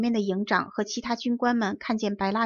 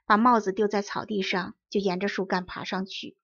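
ordinary delivery

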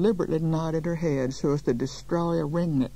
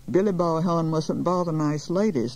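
usual speaking voice

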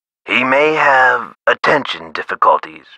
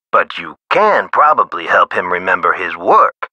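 ordinary delivery

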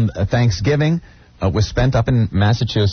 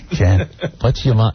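ordinary talk, nothing marked

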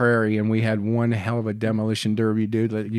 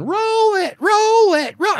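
shrill, much higher mock scream; screaming girlfriend; 4 dB louder, slower than usual. Retelling girlfriend screaming in the Jeep, high mock scream. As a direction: imitate her screaming the order over and over. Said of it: "And she starts screaming".

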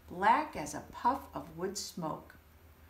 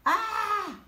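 high loud frightened cry; Turkey's alarmed shriek; startled squawk; 13 semitones higher, 6 dB louder than usual. Acted. Turkey startled in his nest, high shriek.

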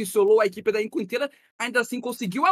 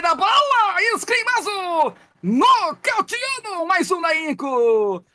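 high, loud caster yell; excited shout; 8 semitones higher, 3 dB louder, much slower than usual. Real, not acted. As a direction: scream the knockout call. Knockout in the final fight.

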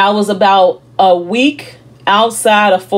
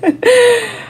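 a sudden high laugh that catches in the throat; emotional laugh choking up; high tearful laugh. Real, not acted. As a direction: laugh breaking toward tears. Breaks down mid testimony.

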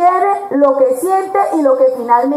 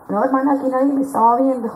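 mock excuse; 3 semitones lower, 4 dB quieter, faster than usual. Girl excusing herself mockingly.

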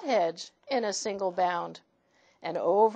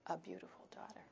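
hushed ominous whisper; soft creepy whisper; 2 semitones lower, 21 dB quieter, much faster than usual. Acted. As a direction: breathe it out as a secret. Dog names its price.

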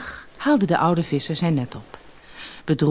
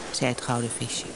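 plain reading voice

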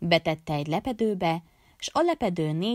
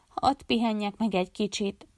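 usual speaking voice

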